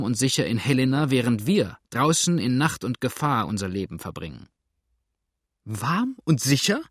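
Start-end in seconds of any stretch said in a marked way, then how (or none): 5.6-6.8 a sharp, indignant echo raised in pitch; sharp retort. A narrator's reading. Widow throws Blackfoot's words back at him, sharply. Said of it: "rief Misses Bridleford scharf".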